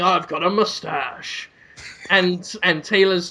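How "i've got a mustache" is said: lightly mocked soldier, slow and lowered; Man-at-Arms. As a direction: drag it out softly as a dull character. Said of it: "Man-at-Arms is there saying".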